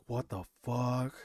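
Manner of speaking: retold stunned letdown, drawn out and subdued; deflated disbelief. Realizing the meeting was just down the street, deflated.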